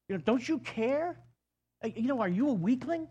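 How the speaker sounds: high, fast mocking challenge; taunting complaint. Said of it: "they basically say to God".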